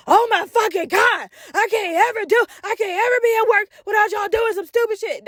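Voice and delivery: high-pitched exasperated mom rant; cussing. Retold or imitated. Mom at work hearing of the injury, cussing. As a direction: imitate a mother fed up on the phone. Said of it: "I hear her cussing on the phone".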